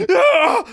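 sudden high yelp of shock; a short piercing gasp-cry; sharp cry of pain. A narrator's reading. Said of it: "she exclaimed".

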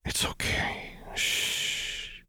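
whispered, very soft hush; tender comforting whisper; soothing coo. A narrator's reading. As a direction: shush someone who is crying. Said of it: "Cythria cooed".

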